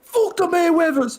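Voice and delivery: high, loud comic Irish yell; Irish-accent shout. Acted. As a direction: shout it in a rough Irish impression. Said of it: "I can only do that shout".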